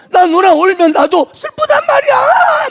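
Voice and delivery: high, loud wail through tears; the little boy bursts out sobbing and shouting; crying child shout. Retold or imitated. Preacher voicing a boy crying out to his sister, tearful shout. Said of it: "막 그러는 거야".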